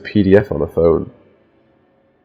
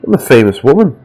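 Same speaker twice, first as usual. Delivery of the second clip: higher-pitched, haughty indignation; higher, swooping, affronted diva; indignant mock shout; 6 semitones higher than usual. A narrator's reading. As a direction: act out a self-important woman taking offence. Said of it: "Anna snaps towards her with this crazy look in her eyes and shouts".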